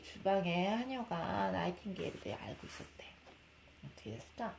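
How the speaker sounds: fast, hushed whisper. Acted. Mother telling her baby the story's secret, a fast whisper.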